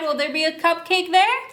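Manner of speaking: usual speaking voice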